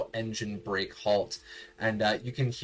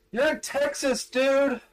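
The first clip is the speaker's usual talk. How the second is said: high, loud, fast metallic yell; gruff ganger shout; 13 semitones higher, 5 dB louder than usual. Acted. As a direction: bark at the old man. Ganger yells at old man.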